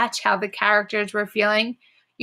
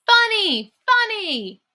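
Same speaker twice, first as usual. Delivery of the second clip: crowd shout; 11 semitones higher than usual. Acted. The crowd happily shouting at Frog. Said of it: "The crowd shouted".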